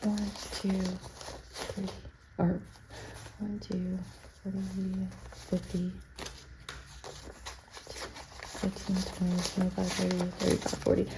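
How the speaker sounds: soft counting under breath; counting quietly. Real, not acted. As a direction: count the bills to yourself.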